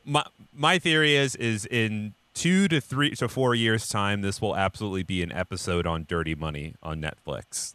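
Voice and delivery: ordinary talk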